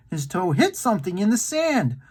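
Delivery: ordinary delivery, nothing marked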